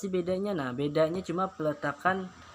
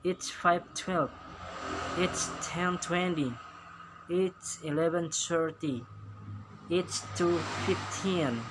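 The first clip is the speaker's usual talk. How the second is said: slow, careful clock times; slow model readings; slower than usual. Shown as a demonstration. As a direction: read each time slowly for the class. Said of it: "Sekarang American style". (membaca jam dengan pelan)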